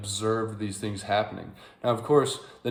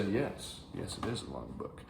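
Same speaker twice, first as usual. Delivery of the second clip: understated boast; 9 dB quieter than usual. Retold or imitated. Acted smug reply to awed listener.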